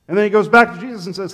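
ordinary talk, nothing marked

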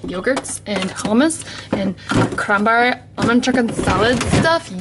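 usual speaking voice

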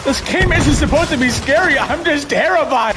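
ordinary talk

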